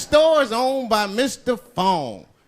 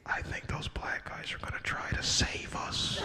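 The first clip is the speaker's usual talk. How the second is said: hushed, very soft stage whisper; scared passenger's whisper; 13 dB quieter than usual. Acted. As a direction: whisper it as a secret hope.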